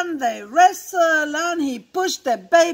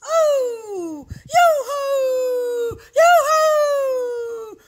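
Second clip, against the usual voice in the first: high, loud yoo-hoo call; excited visitor hallooing at the door; 9 semitones higher, 5 dB louder, much slower than usual. Acted. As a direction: call out in a high, carrying hoot.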